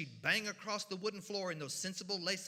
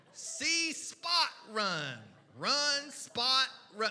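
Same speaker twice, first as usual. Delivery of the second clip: slow, stilted reading, pitched up and louder; early reader read-aloud; 4 semitones higher, 5 dB louder, much slower than usual. Acted. First-graders reading about Spot, slow and raised. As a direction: read each word slowly and loudly like a first-grader. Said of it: "So we would read about Dick, Jane, and Spot".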